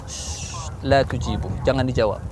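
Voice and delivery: softened warning after a shush; hushing. Acted. Prophet to companions, hushed order not to answer. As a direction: keep them from answering.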